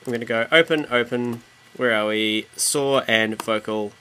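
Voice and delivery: ordinary delivery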